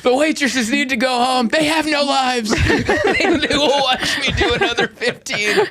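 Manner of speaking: high squealing comic rant; hammed-up desperate blubbering; mock crying. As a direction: wail it tearfully while cracking up. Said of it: "You're crying like".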